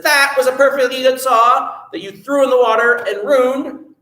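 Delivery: storybook hero losing patience with his family; upset scolding. Acted. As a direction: scold them like a fed-up son. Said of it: "got very upset at this".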